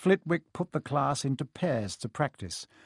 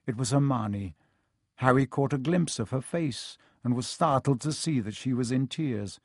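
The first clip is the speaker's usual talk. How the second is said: plain reading voice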